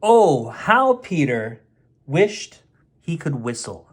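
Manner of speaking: usual speaking voice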